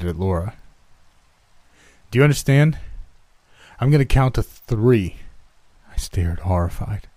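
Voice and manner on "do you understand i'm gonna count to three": raised, higher demanding voice; shouted threat. A narrator's reading. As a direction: call out the ultimatum loudly. Dad threatens with gun again.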